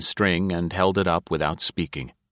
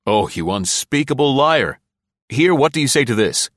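raised, higher-pitched outrage at a liar; angry challenge, voice lifted; indignant accusation; 6 semitones higher, 4 dB louder than usual. A narrator's reading. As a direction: confront the liar hotly, pitch rising.

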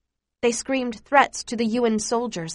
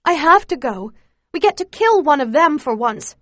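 high, loud, heated insistence; urgent insistence; 6 semitones higher, 7 dB louder than usual. A narrator's reading. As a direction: argue urgently with a raised voice. Acted quarrel, insisting on going.

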